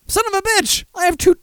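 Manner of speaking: high-pitched startled yelp; surprised outburst. Real, not acted. Host finding messages on his phone, high surprised yelp. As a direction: curse in high-pitched disbelief.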